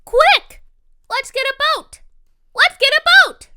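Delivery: high, loud call to a friend; rallying shout for a rescue; urgent yell. A narrator's reading. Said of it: "So I yelled to my friend".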